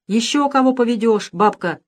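a touch of grumpy anger; mild angry grumble. A narrator's reading. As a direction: grandfather annoyed at taking the goat. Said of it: "сердито проворчал он".

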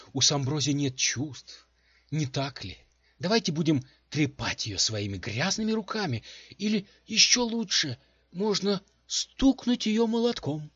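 high, loud, wounded indignation; sarcastic rant. A narrator's reading. Offended ring rants sarcastically.

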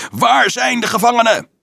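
high, loud devil shriek. Acted. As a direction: shriek it in the man's face. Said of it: "gilde de duivel". (hoog gillende duivel)

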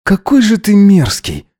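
high-pitched, appalled retort; disgusted outburst. A narrator's reading. Disgusted retort in an acted quarrel.

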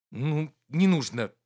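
plain reading voice

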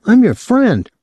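put-on high, excitable young man's voice; a gawking kid, pitched high; young Claude, leering. A narrator's reading. Claude hurt.

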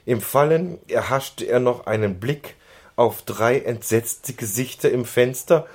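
usual speaking voice

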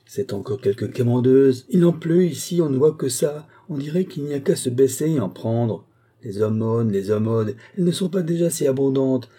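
scolding tirade with loud bursts; indignant housekeeper. Acted. Housekeeper railing at yet another beggar, indignant. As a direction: grumble and flare up at the pests.

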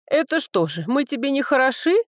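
offended question rising high; indignant. A narrator's reading. Village woman confronting the picky dragon, indignant. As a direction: take offence, pitch climbing. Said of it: "возмутилась Марилька".

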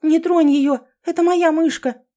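loud, high-pitched alarmed shout; horrified cry. A narrator's reading. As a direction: cry out to protect a pet. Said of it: "в ужасе закричала". (крик в ужасе)

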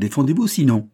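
loud, high furious command; angry challenge. A narrator's reading. Banker orders Raoul to take the revolver, furious command. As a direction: order him to fight. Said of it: "en élevant son arme".

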